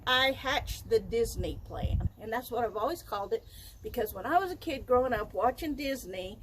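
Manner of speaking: usual speaking voice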